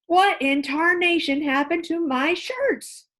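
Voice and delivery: high, loud, slow angry roar; hammed-up outraged father roar. A narrator's reading. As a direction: bellow at the ruined shirts. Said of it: "Mister Rogers roared".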